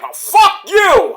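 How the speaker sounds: high, loud, drawn-out curse; angry retort. Retold or imitated. Yuta snapping back at Moxley, mock furious yell. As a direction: yell it back in defiance, slowly.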